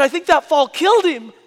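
high, very loud mock wail; distraught little girl, played for laughs; crying child. Little girl telling dad the fall killed him, comic wailing. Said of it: "she's crying".

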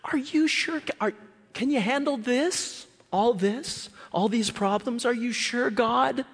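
high, anxious pleading; doubting believer. Retold or imitated. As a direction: nervously bargain with God. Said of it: "all of us are negotiating".

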